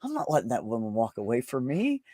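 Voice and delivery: usual speaking voice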